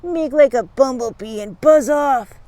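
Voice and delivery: slow, drawn-out bully snarl. A narrator's reading. Bugs warns off the kids, snarling. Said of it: "Bugs saw them and snarled".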